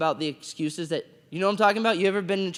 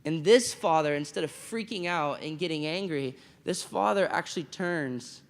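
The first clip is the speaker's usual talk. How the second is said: ordinary delivery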